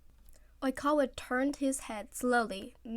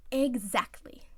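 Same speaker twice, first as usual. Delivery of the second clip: smug; 3 semitones higher, slower than usual. Acted. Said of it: "he smirked".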